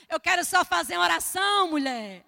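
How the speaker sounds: loud, high acted insistence; insistent plea. Missionary begging Dona Francisca to let her in, insistent. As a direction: plead loudly, falling on the last word. Pleading at the door.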